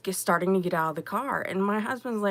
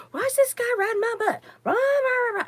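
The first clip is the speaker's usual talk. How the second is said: high, loud, fast ranting grumble; imitating friend grumbling about a tailgater; mocking angry friend; 13 semitones higher, 4 dB louder, faster than usual. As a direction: parody a fuming tailgated driver. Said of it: "And he's like".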